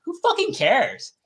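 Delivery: high, drawn-out who-cares scoff; exasperated dismissal. Retold or imitated. Mocking his own Twitter scrolling with an exasperated who-cares scoff. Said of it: "I'm like".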